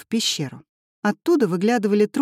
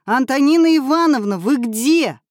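bellowed, high and loud; a boy yells for his teacher at the top of his lungs; yelling at full voice; 8 semitones higher, 6 dB louder than usual. A narrator's reading. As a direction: call out as loud as you can. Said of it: "И завопил во всё горло".

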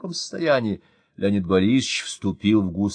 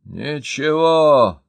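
loud, very slow, dug-in refusal; stubborn; 5 semitones higher, 7 dB louder, much slower than usual. A narrator's reading. City boss stubbornly refusing the fire chief, loud and slow. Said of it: "упрямо сказал городской начальник".

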